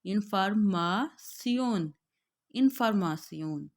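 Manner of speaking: इन्फॉर्मेशन as "in-for-ma-tsion", slowly; German pronunciation. Shown as a demonstration. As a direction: model German इन्फॉर्मेशन carefully. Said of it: "German में है हम इसको read करेंगे".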